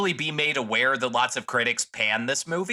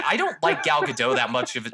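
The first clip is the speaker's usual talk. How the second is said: ordinary talk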